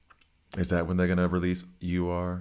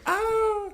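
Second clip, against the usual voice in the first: sudden yelp. Real, not acted. Game timer running out.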